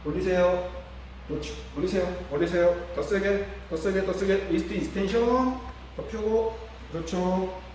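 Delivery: loud, high, urgent commands; loud coaching cues. Shown as a demonstration. Therapist pushing the patient through the lift, loud coaching. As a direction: push the patient hard with your voice. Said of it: "자".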